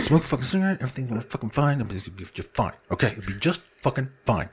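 acted calming down, soft and low; self-soothing. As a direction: talk yourself down under your breath. Talking himself down after fury.

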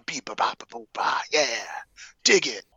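spoken scat syllables, ending on a whispered yeah; imitated jazz scat. Podcaster mimicking the milkshake man who talks in scat, jazz scat. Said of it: "jazz scat the whole time".

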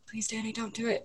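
soft, urgent begging under the breath; very soft pleading voice; hushed plea. Retold or imitated. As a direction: beg a friend quietly to hold back. Friends pleading quietly to restrain her.

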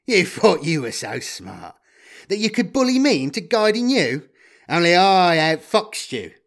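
loud, high gloating; cocky double-crossing guide. Acted. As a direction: crow over outwitting a rival. Magritte gloats after laughing.

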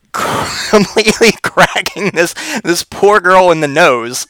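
slow, laughing through words; can't stop laughing. Real, not acted.

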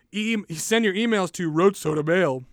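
burp talk; burping the words. Acted. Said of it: "I burp talk".

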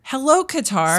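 bright high shout-out; cheerful greeting. Real, not acted. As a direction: wave hello to listeners. Greeting listeners abroad.

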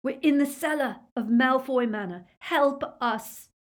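urgent cry for help; desperate yell. A narrator's reading. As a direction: call out desperately for rescue. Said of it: "he yelled at it in mad desperation".

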